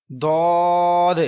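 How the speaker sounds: دود held long in a full heavy voice; teacher recites heavy دود slowly; full heavy letter. Shown as a demonstration. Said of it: "پورا آواز میں پڑھیں گے".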